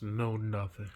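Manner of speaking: ordinary talk, nothing marked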